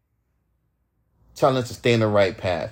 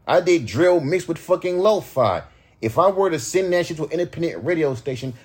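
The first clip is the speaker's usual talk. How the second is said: usual speaking voice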